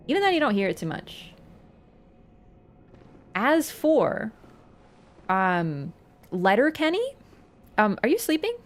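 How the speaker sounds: normal talking voice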